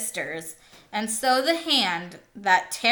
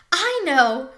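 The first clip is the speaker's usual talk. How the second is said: bursts out eager, a bit higher and louder; eager to show off; proud little outburst; 7 semitones higher, 5 dB louder than usual. A narrator's reading. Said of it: "Ralph was eager to show off his knowledge".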